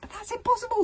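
high-pitched disbelief; astonished stammer. A narrator's reading. Stoic disbelieving the shot, astonished. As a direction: burst out in astonishment. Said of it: "stammered Stoic".

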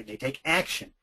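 ordinary talk, nothing marked

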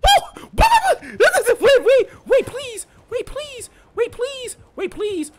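squealing high-pitched and laughing; panicked high squeal. Real, not acted. Panicked squeal while being chased.

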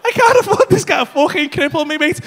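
ordinary talk

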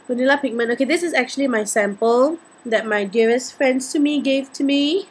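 ordinary delivery

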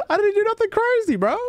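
high-pitched innocent protest; amused protest. Real, not acted. Laughing after player left.

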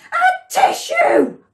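high, loud, drawn-out achoo; huge storybook sneeze; enormous sneeze. A narrator's reading. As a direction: explode in an acted sneeze. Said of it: "Safi sneezed, an enormous sneeze".

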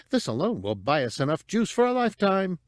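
high, quick crowing; triumphant. A narrator's reading. Cabe bags the nerve disruptor, triumphant. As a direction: gloat over the prize at a fast clip. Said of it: "she said triumphantly".

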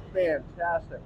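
ordinary talk, nothing marked

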